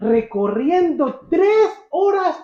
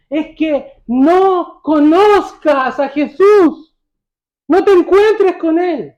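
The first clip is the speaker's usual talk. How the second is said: slow, loud and high, word by word; emphatic preaching; 6 semitones higher, 7 dB louder, much slower than usual. Real, not acted. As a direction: hammer out "no conozcas a Jesús" loud and slow. Preacher hammers Satan's main aim.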